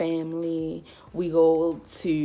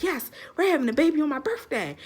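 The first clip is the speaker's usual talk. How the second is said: high, fast excited squeal in imitation; hammed-up excitement, rushed and high; pumped cousin; 9 semitones higher, faster than usual. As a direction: imitate a cousin thrilled by the news. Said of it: "like, pumped".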